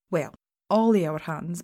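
ordinary delivery, nothing marked

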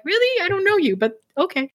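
high-pitched doubt, easing into acceptance; playfully baffled, pitch sliding down; mock incredulity. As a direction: start high and disbelieving, then give in. Acting bemused reply to overfamiliar strangers.

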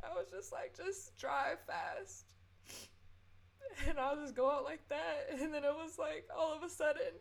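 high, tearful voice breaking through the words; choked-up crying. Real, not acted. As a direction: talk through real tears. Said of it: "I've been crying majority of this episode".

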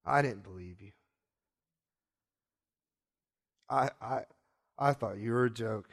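low, halting, subdued; acted humbled, heavy-hearted plea; ashamed confession. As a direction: confess brokenly with long pauses. Peter on his knees confesses.

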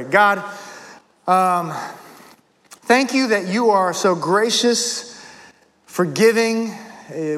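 measured, low-key pastor's prayer; earnest prayer. Real, not acted. Pastor praying to God for help confessing sins, earnest prayer. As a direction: pray aloud sincerely, with reflective pauses.

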